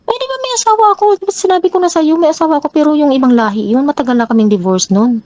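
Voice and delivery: high, put-on voice of a defensive man; mimicking the partner's defensive reply. Quoting partner defending himself.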